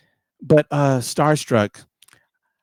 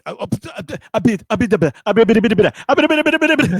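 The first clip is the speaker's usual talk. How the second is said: high, babbling stammer that gets louder; nervous mumbling; 8 semitones higher, 5 dB louder, much faster than usual. Retold or imitated. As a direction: trip over nonsense syllables in rising panic. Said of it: "just mumbling".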